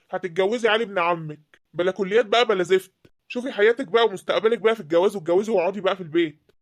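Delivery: أب غاضب يأمر. Acted. الأب يفرض الجواز بغضب.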